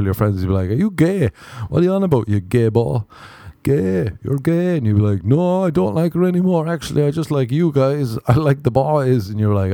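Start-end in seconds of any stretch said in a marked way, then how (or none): 0.7-4.8 higher jeering lads' voice; mocking friends. His friends jeering at him in the pub, jeering. 5.2-9.3 higher, hasty denial to his mates; flustered backpedal. Retold or imitated. A lad retracting his crush to his friends, flustered.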